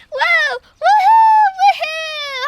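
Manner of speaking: high whooping cheers; high loud woohoos; excited cheering. Real, not acted. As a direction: whoop with party joy. Cheering after the song.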